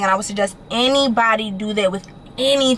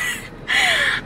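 panicky laugh. Real, not acted. Panicked laugh about forgetting gas.

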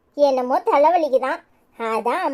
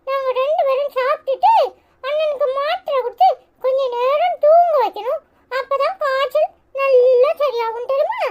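put-on very high small-boy voice, ordering mum about; little brother, bossy; 12 semitones higher than usual. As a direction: pipe up high like a fussing kid brother. (அம்மாவை அதட்டும் குட்டித் தம்பியின் உச்ச குரல்)

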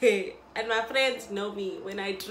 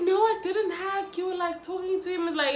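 imitating his denial; 8 semitones higher, 3 dB louder than usual. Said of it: "And I was like".

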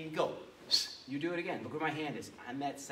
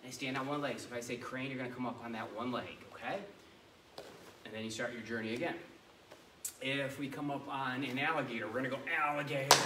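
ordinary delivery

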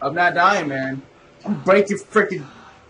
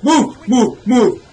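high, loud repeated command; urgent shout; 6 semitones higher, 7 dB louder, much slower than usual. Real, not acted. Gamer urging the character to move, urgent. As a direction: fire off urgent orders.

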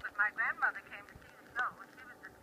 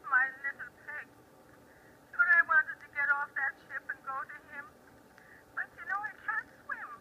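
wistful Irish brogue rising at the close; 5 semitones higher than usual. Acted. Irish daughter wanted off the ship to reach her father, brogue. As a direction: carry the tale home in Irish brogue. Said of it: "why not continue it for us?".

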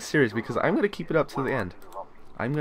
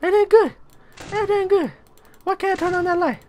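very high, squeaky panic voice; frightened squeal. Real, not acted. Player unable to turn on that light, frightened squealing. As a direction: squeak the pleas out in fear.

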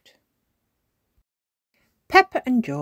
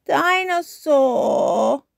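put-on high, drawn-out toddler wail; sobbing toddler; 10 semitones higher, 9 dB louder, much slower than usual. A narrator's reading. George without his toy at bedtime, wailing sob. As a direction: wail the word slowly, as a storybook sob. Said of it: "sobbed George".

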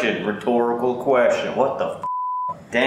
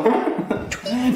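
giggle; 9 semitones higher than usual. Real, not acted. Laughing at a prank.

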